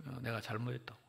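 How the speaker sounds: ordinary delivery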